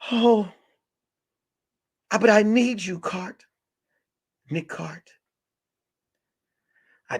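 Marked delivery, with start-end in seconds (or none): 0.1-5.1 high-pitched put-on female plea; pleading woman client. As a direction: beg him in a high woman's voice. Woman client begs detective to stay.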